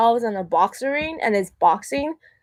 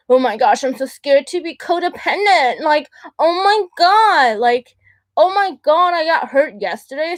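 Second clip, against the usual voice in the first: higher-pitched, hammed-up panic; mock fearful; 6 semitones higher than usual. Mocking people who live every day in fear, mock fearful. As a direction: imitate someone dramatically scared of everything. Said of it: "live every day in fear".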